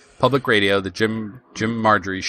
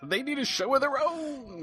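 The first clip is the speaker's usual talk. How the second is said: high, softer mock-fan voice; excited caller; 14 semitones higher, 9 dB quieter than usual. Co-host extending the imagined caller's praise, mock excitement. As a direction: chime in as a pretend gushing caller.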